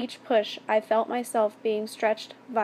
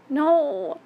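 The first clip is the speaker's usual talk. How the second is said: higher, louder drawn-out cry; wailed protest; 5 semitones higher, 5 dB louder than usual. A narrator's reading. Victim crying out when it is too late, wail. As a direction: wail it out, raised in pitch. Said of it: "I wailed".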